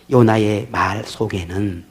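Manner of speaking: ordinary talk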